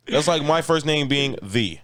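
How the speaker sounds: usual speaking voice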